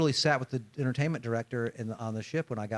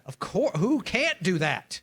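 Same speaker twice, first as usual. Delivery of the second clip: mock incredulous; 7 semitones higher, 3 dB louder, slower than usual. Real, not acted.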